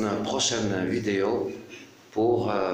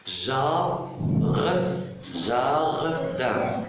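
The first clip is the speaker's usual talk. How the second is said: "Jar-, jardin" stretched, with a long break after the first syllable; split into syllables; 3 semitones higher, much slower than usual. Shown as a demonstration. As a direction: sound out "jardin" slowly in two syllables. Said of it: "Deux syllabes". (« jardin » en deux syllabes détachées et étirées)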